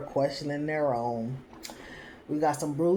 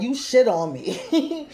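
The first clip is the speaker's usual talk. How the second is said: laughing mock accusation; 6 semitones higher, 3 dB louder, slower than usual. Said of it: "she's like".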